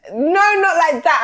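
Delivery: high, loud no; emphatic correction. Real, not acted. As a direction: cut in firmly and loudly. Correcting the other speaker.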